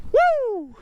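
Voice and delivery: high whooping cheer; excited high woo. Real, not acted. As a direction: let out a loud, high whoop of joy.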